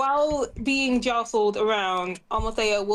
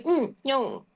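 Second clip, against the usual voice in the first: pouting. Acted. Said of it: "Just pouting".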